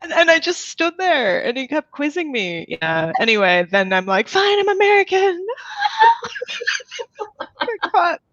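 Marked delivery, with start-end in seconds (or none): 4.3-8.2 laughing. Retold or imitated. Caught lying, laughing admission.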